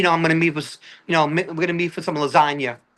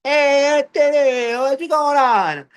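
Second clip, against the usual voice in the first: big, high, drawn-out holler; loud hammed-up hail, stretched slow at the end; mock Italian-American greeting; 10 semitones higher, 4 dB louder, much slower than usual. People imitate the Italian comic, mock Italian greeting. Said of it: "they start acting like me".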